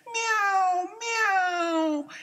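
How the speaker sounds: high, thin mewing like a sad kitten; high kitten meows. Acted. Kittens mewing sadly to their mother, very high. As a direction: meow like a tiny crying kitten.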